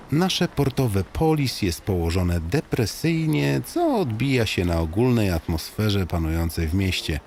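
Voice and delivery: usual speaking voice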